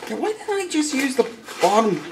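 quick high squeaky self-scold; mock yourself in a squeaky voice; high, fast jab at himself; high self-mocking aside. Real, not acted.

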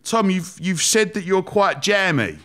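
high-pitched, drawn-out imitation of a boss; questioning boss. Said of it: "And he went".

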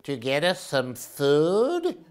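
slow, drawn-out worried owl voice; slow middle brother owl. Acted. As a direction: stretch out goodness, fretting. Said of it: "said Percy".

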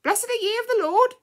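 very high, loud parrot squawk; piercing bird voice on one held high pitch; parrot mimicry. A narrator's reading. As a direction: screech it out like a parrot reciting. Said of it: "The parrot was very clear and had learnt to mimic well".

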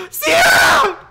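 very high, loud shriek; screamed call. Acted. Calling friend Sierra after eviction, high scream. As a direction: scream the name for help.

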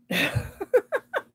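bright rising laugh; high giggle. Real, not acted. Laughs at cat knuckles joke.

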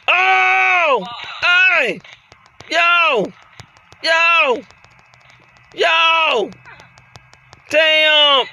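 high, drawn-out cries; stretched hollers of dismay; shocked yelling. Real, not acted. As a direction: yelp long at each blow landed. Reacting to repeated blows.

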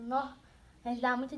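ordinary talk

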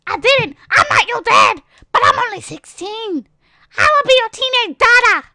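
high, loud put-on protest; mock teen outburst. Avatar teen telling a player about being adopted, mock outburst. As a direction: shout it in a hammed-up high voice.

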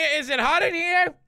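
rising, high agitated questions; flustered outcry. Real, not acted. Flustered after claiming not scared.

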